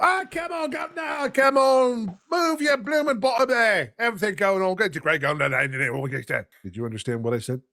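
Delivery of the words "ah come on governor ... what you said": put-on Cockney accent. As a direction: holler it like a London street Cockney. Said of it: "different types of English accents".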